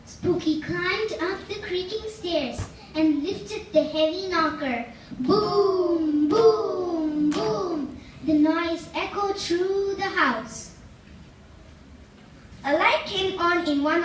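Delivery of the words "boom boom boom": knocking sound. Acted. Knocking on heavy door.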